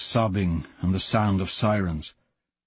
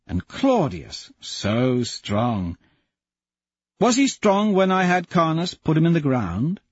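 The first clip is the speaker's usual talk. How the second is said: bitter accusing jackal; 4 semitones higher than usual. Acted. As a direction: lay out every grievance. Jackal accusing his father.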